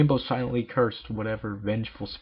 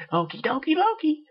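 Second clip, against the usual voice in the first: high, louder sing-song chirp; Pinkie cheer; 5 dB louder than usual. Acted. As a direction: bounce it out brightly.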